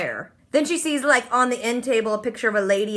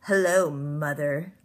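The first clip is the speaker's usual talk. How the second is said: much lower, slow, soft ominous delivery; dramatic TV reveal; 8 semitones lower, 5 dB quieter, much slower than usual. Pretend. [name] greeting her mother TV-drama style, low, slow and ominous. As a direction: play the cheesy soap-opera twist line. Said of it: "that thing that every T V show does".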